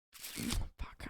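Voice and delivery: breathy whispered swear under the breath; whispered curse. Retold or imitated. To herself after the agent refuses, whispered. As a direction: let the curse slip out in a whisper. Retold reaction to ticket problem.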